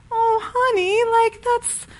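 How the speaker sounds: put-on gently calm a crying child; tender comfort. Mom comforting her crying daughter, tender soothing voice.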